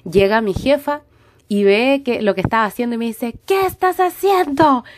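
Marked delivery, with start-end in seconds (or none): 3.5-4.8 high, sharp boss scolding, imitated; slap-like voice. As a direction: snap at her like a slap. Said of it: "como con voz de cachetada". (imitando a la jefa con voz de cachetada, aguda)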